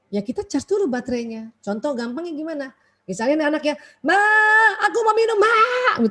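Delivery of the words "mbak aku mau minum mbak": put-on higher, louder bossy child yelling; mock yell. Child yelling at the maid for a drink, bossy shout. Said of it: "Teriak gitu kan?".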